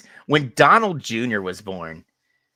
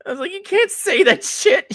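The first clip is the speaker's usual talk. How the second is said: much higher, louder scandalized protest; laughing high-pitched shock; shocked outburst; 9 dB louder than usual. Retold or imitated. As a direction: yelp at grandma in disbelief. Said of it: "And I'm like".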